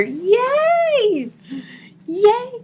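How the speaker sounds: high, loud cheer of delight; jump-up-and-down yay; excited squeal. Retold or imitated. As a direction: squeal it like a thrilled fan. Reading a viewer's excited comment.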